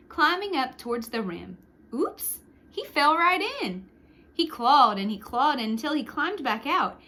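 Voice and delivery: put-on lively read-aloud for children; storyteller voice for kids; story narration. A narrator's reading. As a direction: tell it like a picture book to a class. Reads picture book aloud.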